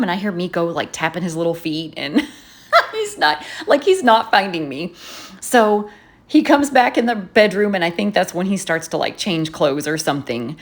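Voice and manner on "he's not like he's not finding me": ordinary talk, nothing marked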